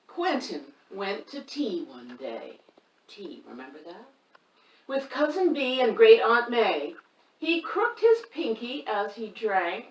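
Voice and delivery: lively picture-book reading; reading to children. A narrator's reading. Reader performs the rhyming book for children. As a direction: read it to the kids with bounce.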